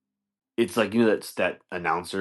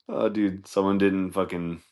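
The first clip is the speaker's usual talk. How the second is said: ordinary talk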